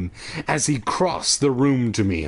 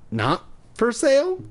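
high, startled stammer; stammered surprise; 10 semitones higher than usual. A narrator's reading. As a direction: squeak it out in disbelief. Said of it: "I stammered".

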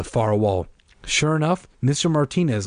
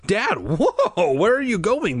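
high-pitched alarmed call; startled, high questioning cry; 10 semitones higher, slower than usual. A narrator's reading. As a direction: call after a departing father. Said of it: "Margaret called".

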